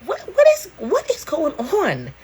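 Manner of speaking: squeaky high disbelief, slowing on the last words; what is going on, in shrill shock; mock alarm. Real, not acted. Vlogger reacting to strange hair growth, high shocked voice.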